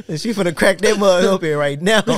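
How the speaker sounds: high-pitched, talking through laughter; laughing. Real, not acted. Friend joking about someone about to act, laughing. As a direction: crack up while saying it.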